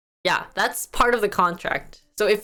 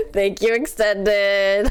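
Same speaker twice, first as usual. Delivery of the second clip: laughing while thanking; 6 semitones higher, much slower than usual. Real, not acted. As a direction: giggle through the thanks. Laughing at chat.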